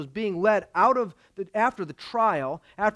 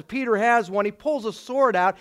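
ordinary delivery

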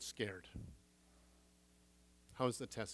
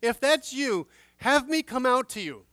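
Peter calling out; 8 semitones higher, 8 dB louder than usual. Acted. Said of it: "he calls out".

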